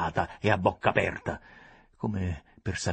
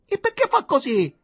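much higher, louder frightened question; horrified question; 9 dB louder than usual. A narrator's reading. Lady asks in fright about the dying man. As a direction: still shaken, crying out.

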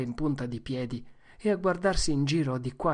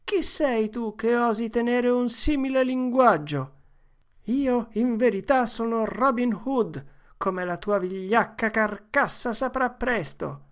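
higher, grand storybook speech; boys' heroic play-acting; 9 semitones higher, 3 dB louder than usual. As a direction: declaim like an outlaw hero. (duello recitato tra ragazzi, tono eroico)

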